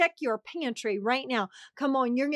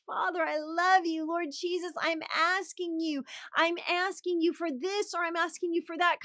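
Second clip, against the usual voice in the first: modelled prayer; 5 semitones higher than usual. Shown as a demonstration. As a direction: show them how to pray it. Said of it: "Say, ask him".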